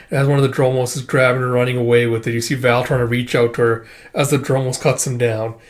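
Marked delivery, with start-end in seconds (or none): none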